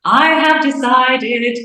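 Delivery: sister's mock-grand decisive answer; triumphant declaration. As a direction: announce it like a grand verdict, drawn out. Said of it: "And she's like".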